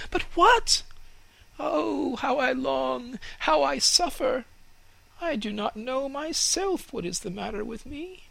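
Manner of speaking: very high, aching cry of longing; a young tree crying out in longing; young fir tree pining; anguished fir tree. Acted.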